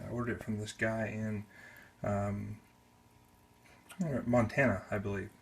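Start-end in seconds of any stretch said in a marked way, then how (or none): none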